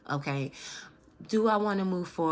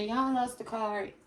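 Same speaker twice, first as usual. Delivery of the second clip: emotional; 3 semitones higher than usual. Real, not acted. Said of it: "I'm extremely emotional".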